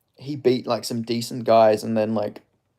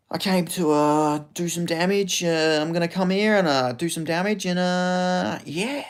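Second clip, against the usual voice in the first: higher, light fighter voice; a hint of the laid-back fighter drawl; Jack impression; 6 semitones higher, slower than usual. Shown as a demonstration. Streamer does a Jack Della Maddalena impression answering a chat request. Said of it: "Jack impression".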